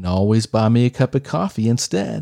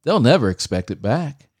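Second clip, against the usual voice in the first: usual speaking voice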